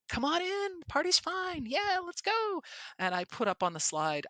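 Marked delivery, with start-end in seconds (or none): 0.1-2.6 much higher, bubbly and upbeat; party host hype. Shown as a demonstration. Greeting the audience as a party host, high and bubbly. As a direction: pump up the room. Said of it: "So I'm like".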